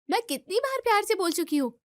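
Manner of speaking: high, indignant retort; exasperated protest. Acted. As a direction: protest that you have been patient long enough. Wife protests to mother-in-law. (खीझकर ऊँची आवाज़ में सफ़ाई देना)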